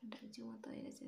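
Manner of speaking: whispered through a swollen lip; whispering. Real, not acted. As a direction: whisper it, stressing উঁচু. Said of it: "তো কথা বলতে একটু অসুবিধা হচ্ছে এখানটায়".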